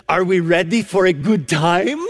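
imitated Romanian grandmother, question lifting at the end; Romanian accent. Grandmotherly teacher greeting a stranger at the elevator, Romanian accent. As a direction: put on a Romanian accent, playfully eager. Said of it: "she says in this unique accent".

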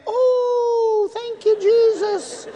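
high, drawn-out praise cry; imitated rejoicing shout. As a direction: play Mama shouting praise from the background. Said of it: "Mama's shouting".

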